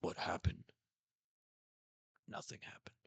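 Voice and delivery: hushed breathy whisper, downplaying; whispered Zoro. Zoro brushing off Sanji's question, whispered. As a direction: whisper it like it was nothing. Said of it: "And Zoro just goes".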